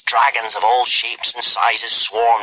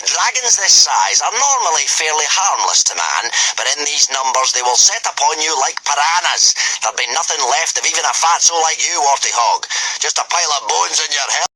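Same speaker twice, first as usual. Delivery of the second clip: commanding Gobber; 7 semitones higher than usual. A narrator's reading. Gobber sending the boys up the cliff, commanding. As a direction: order the boys like a drill master.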